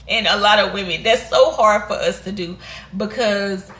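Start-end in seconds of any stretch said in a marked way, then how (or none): none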